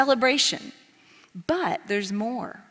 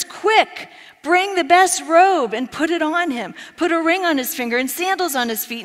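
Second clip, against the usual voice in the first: urgent father; 7 semitones higher, 6 dB louder than usual. Acted. Father urgently orders his servants.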